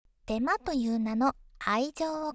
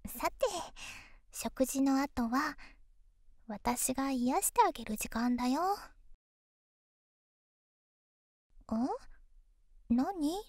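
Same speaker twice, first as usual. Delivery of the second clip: pillow talk; 2 semitones lower than usual. Acted. Girlfriend soothing tired boyfriend.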